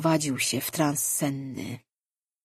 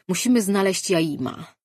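ordinary delivery, nothing marked

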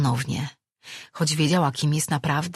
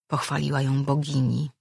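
plain reading voice

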